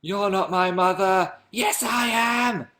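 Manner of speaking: high, slow melodramatic soap actress; milk every word like a soap cliffhanger; soap opera drama. Acted. A YouTuber mocking EastEnders acts out a scene in a high, slow melodramatic voice. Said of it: "EastEnders is so depressing".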